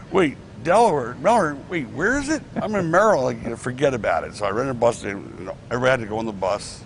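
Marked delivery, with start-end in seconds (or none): 0.1-3.2 confused wrestler. Retold or imitated. Imitating confused wrestlers lost.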